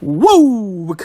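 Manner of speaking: high, loud spooky "Wuuu" like a ghost; playful ghost woo. Pretend. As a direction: draw the name out as a cheeky ghost moan. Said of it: "Let me lick my lips".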